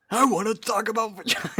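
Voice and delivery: whiny bored mimicry; mocking voice. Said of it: "How's it go?".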